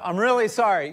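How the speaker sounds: loud, fast acted "sorry"; hurried mock apology. Repeat offender apologizes yet another time, hurried and loud. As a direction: rattle off a loud, insistent apology.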